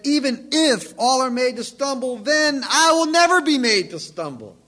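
loud, proud boast of Peter; boastful vow. Retold or imitated. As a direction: swagger through the vow loudly. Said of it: "man doesn't keep his word".

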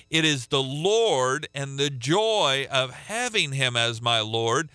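usual speaking voice

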